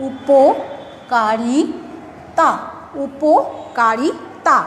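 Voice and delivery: উপকারিতা spoken very slowly; slow syllable demo. Shown as a demonstration. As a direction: stretch উপকারিতা out for the class. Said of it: "তখন কি হয়ে যাবে?".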